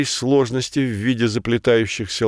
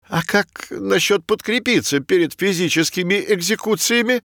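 hopeful; 9 semitones higher than usual. Acted. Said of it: "с надеждой спросил Пушок".

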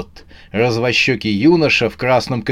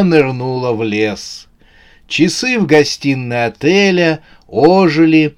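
usual speaking voice

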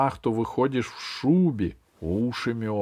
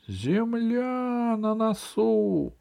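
sudden cry; 11 semitones higher, much slower than usual. A narrator's reading. Lookout Fuks suddenly sights land and cries out. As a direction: call it out high, stretching the words. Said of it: "неожиданно воскликнул".

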